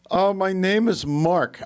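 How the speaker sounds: high, slow, put-on voice; mock call-center caller. Overseas spam caller giving a false name, mock caller voice. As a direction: stretch out the introduction in a high imitation.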